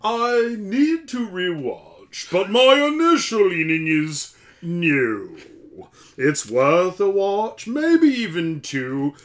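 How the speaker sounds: very slow, drawn-out put-on voice; Zach Appleseed. Co-host voicing a listener's verdict, slow drawn-out impression. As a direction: draw every phrase out grandly. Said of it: "that's how Zach says it".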